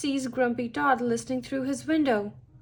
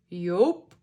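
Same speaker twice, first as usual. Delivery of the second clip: lower, slightly gruff character reply; a hint of a grumpy character voice; lightly voiced grumpy toad; 5 semitones lower than usual. A narrator's reading. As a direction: say it low, in character. Grumpy Todd voiced in picture story.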